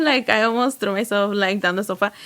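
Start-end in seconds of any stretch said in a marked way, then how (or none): none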